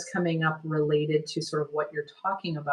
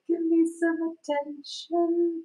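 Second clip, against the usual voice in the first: much higher, slow plea like a cat; affectionate cat voice; 13 semitones higher, slower than usual. Retold or imitated. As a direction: beg for attention like a needy cat. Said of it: "like, rub up on the leg".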